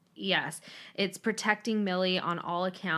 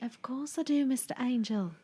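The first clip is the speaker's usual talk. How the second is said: high-pitched British character voice; British accent imitation; 5 semitones higher than usual. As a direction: echo the British audiobook lady, pitched up. Echoing the British audiobook voice.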